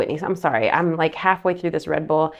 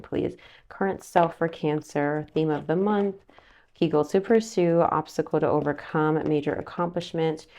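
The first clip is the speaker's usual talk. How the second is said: softer, measured recital of the card positions; soft tarot reading; 2 semitones lower, 6 dB quieter than usual. Acted. Laying out Cancer's spread, soft recital. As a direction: list the spread calmly and quietly.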